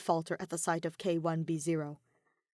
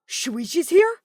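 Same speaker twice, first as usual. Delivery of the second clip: higher, louder surprised question; startled realisation; 10 semitones higher, 12 dB louder, slower than usual. A narrator's reading. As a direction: astronaut suddenly alarmed. Kaito startled.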